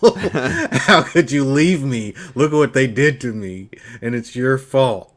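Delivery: half-laughing mock outrage; mock accusation. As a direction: act out a betrayed friend lashing out. Voices Griffith accusing Guts.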